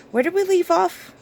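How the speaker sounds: usual speaking voice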